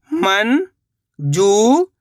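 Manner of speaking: मंजूर stretched very slowly, rising at the end; slow drawn-out reading. Shown as a demonstration. Teacher sounding out मंजूर for pupils, very slow and rising. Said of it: "शब्द को आपको खुद से पढ़ना है".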